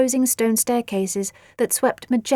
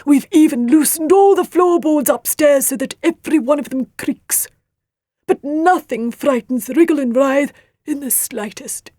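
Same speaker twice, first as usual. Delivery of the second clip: beetle father voice; 5 semitones higher, 5 dB louder than usual. A narrator's reading. Leafmould's voice in the story.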